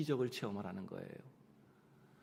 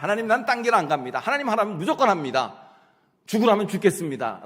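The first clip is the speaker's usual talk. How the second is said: loud and fervent, pledging everything; a believer loudly vowing total obedience to God; 8 semitones higher, 10 dB louder than usual. Retold or imitated. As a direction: proclaim it like a solemn oath. Vow of obedience quoted.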